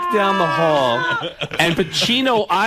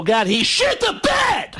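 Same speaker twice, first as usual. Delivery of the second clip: much higher, slow indignant yell; mock outraged shout; 14 semitones higher, slower than usual. Radio host exposing the bed incident, shouted. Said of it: "turn on your radio and go".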